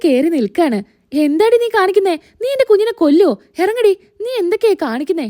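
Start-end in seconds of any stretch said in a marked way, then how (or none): 1.2-5.2 high-pitched outraged mother-in-law; acted indignant outburst; alarmed scolding. Mother scolds daughter-in-law on son.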